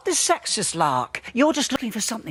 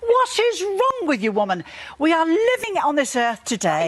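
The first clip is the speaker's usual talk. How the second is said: high-pitched, exasperated outburst; real exasperation; 5 semitones higher than usual. Real, not acted. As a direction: scold your opponent, pitch leaping up. Real exasperation in a heated debate.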